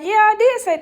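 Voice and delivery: high, loud and challenging; re-enacted defiant comeback; defiant reply. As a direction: own it loudly, ready to argue.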